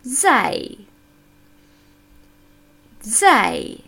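"zai" drawn out; "zai" said alone, crisp and careful; over enunciating. Shown as a demonstration. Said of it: "Sagen wir jede Silbe einzeln".

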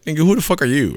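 high-pitched, indignant comeback; affronted high-voiced question; incredulous challenge. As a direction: throw it out high, in disbelief. Acts out his challenge to stranger.

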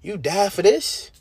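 high, slow, astonished plea to the Lord; stunned, rising "You die for this?" to God; incredulous prayer. Retold or imitated. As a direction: ask it like you can't believe it.

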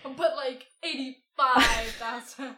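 mocking echo. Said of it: "mocks the line back".

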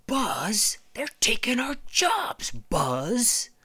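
high, whiny put-on voice; Woody complaining. Woody griping to Buzz about jobs, high whine. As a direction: grumble like a bitter old townie. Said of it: "Woody complains".

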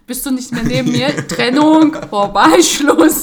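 loud, emphatic playful threat; building to a shouted, hammed-up ultimatum; mock ultimatum. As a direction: punch out each word as a mock breakup. Acts out ultimatum to partner.

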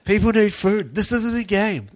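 high, loud, pleading put-on voice; desperate Hurley. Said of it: "Hurley being, like, fully desperate about food".

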